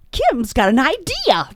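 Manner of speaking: loud, high imitation of an eager colleague; mock announcement. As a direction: call it out as if volunteering a friend. Acts colleague volunteering her publicly.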